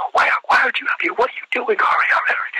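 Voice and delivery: fast, high, frantic drill-instructor yelling; mock screaming. Sergeant rushing the candidate searching for a ruler, mock screaming. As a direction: hound the recruit in an imitated scream. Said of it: "he's screaming at me".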